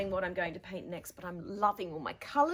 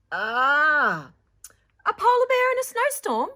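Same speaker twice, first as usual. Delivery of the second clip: much higher, louder playful teacher, quick; 10 semitones higher, 15 dB louder than usual. Acted. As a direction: tease gently like a cheerful art teacher. Teacher's joking line in story.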